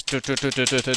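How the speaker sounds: rapid run of footstep noises; imitated footsteps. Storyteller hearing zombie on the stairs, imitated footsteps. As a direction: patter out footsteps coming downstairs. I can hear his footsteps.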